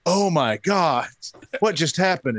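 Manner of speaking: high, slow stunned disbelief; can't believe what you just saw; shocked. Retold or imitated. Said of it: "I was just like".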